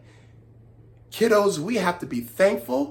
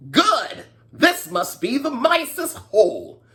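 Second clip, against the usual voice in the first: put-on eager high old man voice; gleeful greedy old man spotting the hole; 7 semitones higher, slower than usual. Greedy old man gloating over the mice's hole.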